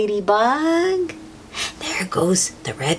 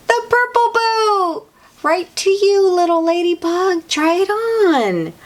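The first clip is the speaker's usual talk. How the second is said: high, laughing delight; excited reveal; 11 semitones higher than usual. Acted. Teacher excitedly giving the purple boot to ladybug. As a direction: present the boot gleefully.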